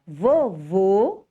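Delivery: vovô with closed, rounded ô; teacher's demo of vovô, closed ô; closed final vowel. Shown as a demonstration. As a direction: say vovô slowly with closed o sounds. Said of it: "de forma fechada".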